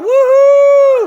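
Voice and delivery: high, loud woohoo cheer; shout. Shown as a demonstration. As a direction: whoop it out to show the crowd. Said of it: "I just want you to shout".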